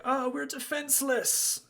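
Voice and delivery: high despairing cry; panicked yell. Acted. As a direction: cry out helplessly, pitched high. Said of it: "some are yelling things like".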